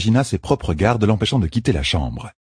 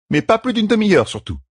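firm insistence. A narrator's reading. Midwife sets a strict limit for the queen. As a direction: lay down the limit hard.

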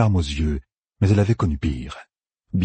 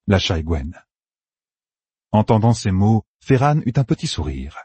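ordinary delivery

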